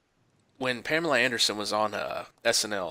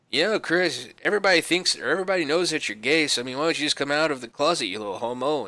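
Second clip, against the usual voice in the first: louder, raised-pitch tirade; mock berating; 5 semitones higher, 4 dB louder than usual. Norm berating Kattan before air, mock angry. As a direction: tear into him in front of everyone. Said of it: "berates him".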